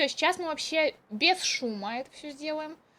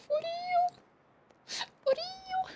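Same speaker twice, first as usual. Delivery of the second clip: squeaky high for real; disbelieving high squeak; incredulous; 7 dB quieter, much slower than usual. Real, not acted.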